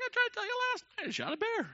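very high, loud, fast squeal; exasperated protest. Retold or imitated. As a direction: protest in a comic falsetto yelp. Exasperated defence to the group.

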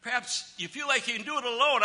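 ordinary talk

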